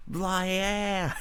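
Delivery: a lazy Aussie agreement in a mock accent; jokey Australian accent. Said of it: "the Aussie".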